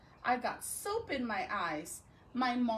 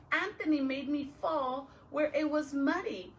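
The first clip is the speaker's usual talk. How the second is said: plain reading voice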